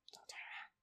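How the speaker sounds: breathy ghost-story whisper; eerie whisper. Pretend. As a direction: breathe it like a haunting. Creepy whispered warning.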